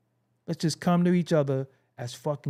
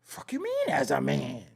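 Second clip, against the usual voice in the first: incredulous retort; 3 semitones lower, 5 dB quieter than usual. Retold or imitated. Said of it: "it's like".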